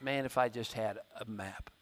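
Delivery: low, soft wistful mutter; lightly acted wish under the breath; prayerful wish. Lost person praying for a map, low and soft. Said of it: "You pray for a map".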